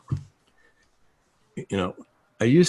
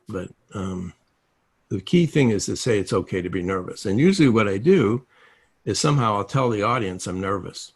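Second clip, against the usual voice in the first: ordinary talk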